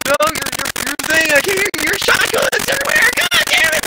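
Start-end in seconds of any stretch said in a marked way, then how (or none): 1.3-3.9 high-pitched, frustrated rant; high, strained complaint; exasperated yelling. Real, not acted. Ranting at David's noisy microphone.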